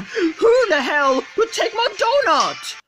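frantic, whiny wail, very high; high-pitched panic. Acted. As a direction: shriek it in despair, way up high. Freaking out over lost donut.

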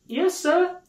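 very high, polite little voice; servant girl. Acted. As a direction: answer as a deferential maid. Servant girl answers Scrooge.

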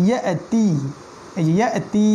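ইয়াতি broken after "ya", with the final "ti" held long; drawn-out vowel. Shown as a demonstration. Teacher shows where to lengthen, drawn-out final vowel in ইয়াতি. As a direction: stretch the last "ee" of ইয়াতি. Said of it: "এখানে আবার টান দিতে হবে".

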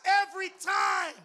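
ordinary talk, nothing marked

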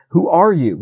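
a storyteller's yelping dog; yelped challenge. A narrator's reading. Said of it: "he yelped".

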